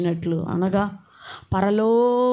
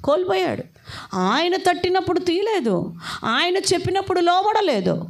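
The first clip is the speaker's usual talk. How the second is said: lost, lost, much higher and pleading; preaching cry; 10 semitones higher than usual. Acted. Preacher lamenting Saul to the congregation, much higher. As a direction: cry it out over the lost grace.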